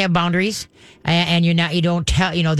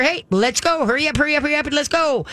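higher, bright cheering call to the dog; happy perky call; 8 semitones higher than usual. Shown as a demonstration. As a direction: sing it out happily like coaxing a puppy. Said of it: "it's gotta sound happy, perky, positive".

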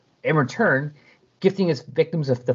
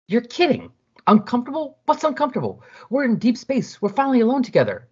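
put-on high, put-out girlfriend voice; Mary Jane protesting to Comet, high and whiny; 11 semitones higher than usual. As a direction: complain up high, a little hurt. Mary Jane upset at Comet's refusal.

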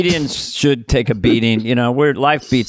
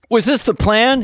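passenger's question; 8 semitones higher than usual. Retold or imitated. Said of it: "the passenger goes".

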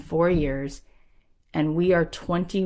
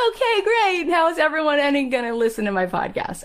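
exasperated retort; 10 semitones higher than usual. Retold or imitated. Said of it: "I was like".

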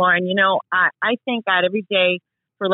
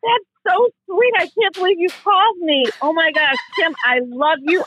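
high-pitched, overwhelmed gushing; genuinely thrilled; 6 semitones higher than usual. Real, not acted. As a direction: gush to your idol, overjoyed. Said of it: "I am thrilled".

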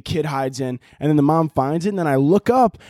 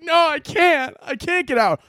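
put-on high, whiny kid voice; mock-helpless high whine; trapped child; 12 semitones higher than usual. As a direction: wail back like a stuck little kid, hammed up. Acts trapped kid in the dryer.

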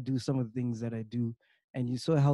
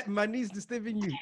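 normal talking voice

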